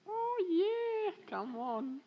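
a mock swooning admirer; admiring coo. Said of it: "you saw my little girl, like".

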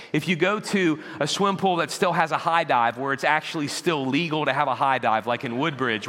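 ordinary talk, nothing marked

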